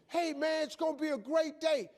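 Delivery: high-pitched, chirpy morning pep call; bursting with morning cheer; relentlessly upbeat caller. Acted. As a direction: imitate an overly cheerful wake-up caller. Imitating sponsor's upbeat morning call.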